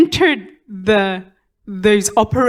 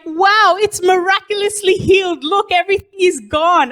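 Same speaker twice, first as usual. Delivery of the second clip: amazed surgeon; 8 semitones higher than usual. Retold or imitated. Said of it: "they'll be like".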